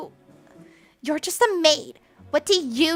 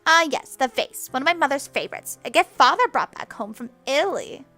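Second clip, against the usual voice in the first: lofty, careless, quick and raised; airy dismissal; 3 semitones higher, 6 dB louder than usual. Acted. Spoiled girl brushes off the broken vase, airy and careless. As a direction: toss it off like nothing matters.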